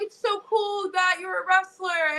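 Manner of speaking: high-pitched mock gushing; imitating a starry-eyed onlooker; admiring fan. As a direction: coo it like an impressed fan. Mimics admiring fans.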